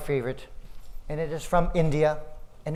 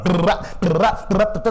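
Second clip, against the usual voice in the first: rolled drum imitation; 3 semitones higher, 7 dB louder than usual. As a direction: roll the r like a snare drum.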